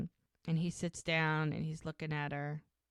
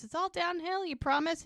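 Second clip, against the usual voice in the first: much higher, pleading question; high hopeful plea; 11 semitones higher than usual. Retold or imitated. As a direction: squeak it out, hoping. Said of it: "And she goes".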